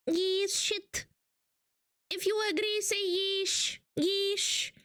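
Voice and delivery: normal talking voice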